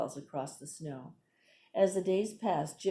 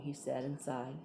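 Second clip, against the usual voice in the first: plain reading voice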